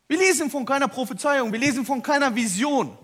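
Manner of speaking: loud, high, driving sermon cry; full-force preaching. Acted. Preacher proclaiming loudly to the church. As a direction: hammer each point home to the congregation.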